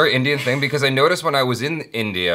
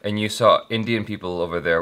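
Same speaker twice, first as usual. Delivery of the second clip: usual speaking voice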